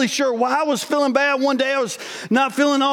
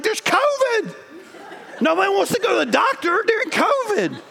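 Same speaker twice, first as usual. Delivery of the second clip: high, disbelieving there's covid; incredulous; 7 semitones higher than usual. Retold or imitated. To his wife, incredulous and pitched high. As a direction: scoff at the idea, pitched way up.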